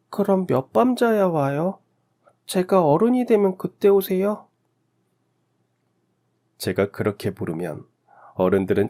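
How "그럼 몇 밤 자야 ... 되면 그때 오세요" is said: put-on high, slow-ending little child's voice; naive child. A narrator's reading. Orphaned child asks when parents return, innocent high child voice. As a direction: ask innocently as a small child, pitched high. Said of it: "순진하게 물었습니다".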